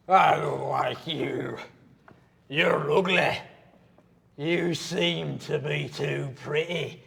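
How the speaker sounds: a hint of a dim drunk giant; dim giant cyclops.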